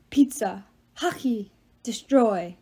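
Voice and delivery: a hint of a dim brute; dim ox Cal. A narrator's reading. Ox offers the words he knows, lightly voiced dimwit.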